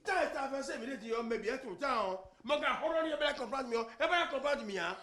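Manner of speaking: loud, high-pitched tirade; shouting. Acted. Husband rails about the visiting relatives, shouting. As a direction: keep hollering for all to hear. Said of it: "je vais crier".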